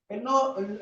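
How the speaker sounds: ordinary talk, nothing marked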